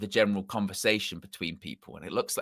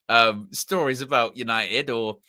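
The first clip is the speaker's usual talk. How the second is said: ordinary talk, nothing marked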